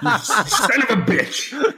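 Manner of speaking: high, slow, laughing mock outrage; wrestling promo. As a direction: stretch it out like a wrestler. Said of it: "devolved into a wrestling promo thon".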